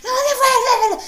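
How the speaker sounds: very high, loud laughing shout. Retold or imitated. Shouting at the phone thief to hand it back, laughing. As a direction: yell at him to give it back. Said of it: "Le digo".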